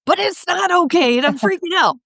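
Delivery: high, mock-panicked parent cry. Worried parent protesting to the coach, mock panic. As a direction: squeal it like a parent losing it. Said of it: "parents out there, I'm sure, going".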